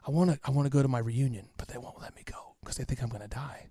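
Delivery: very soft, faint voice of a sick father in a breathing mask; weak dying father. Retold or imitated. Sick father asking his son to plan the reunion, faint and weak. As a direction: barely get the words out, as if each breath costs. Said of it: "he could barely whisper".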